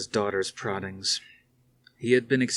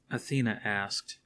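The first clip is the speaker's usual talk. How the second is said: ordinary delivery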